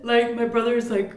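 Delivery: usual speaking voice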